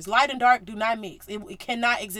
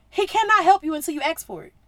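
normal talking voice

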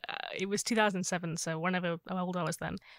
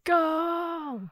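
high drawn-out goal yell; long goal yell; 9 semitones higher than usual. Retold or imitated. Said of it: "So it was like".

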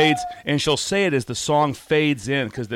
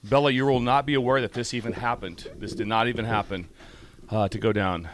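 ordinary talk

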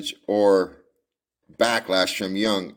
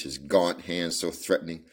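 ordinary delivery

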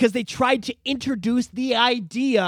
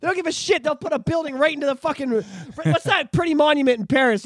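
usual speaking voice

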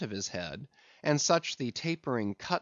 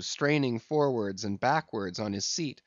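ordinary delivery

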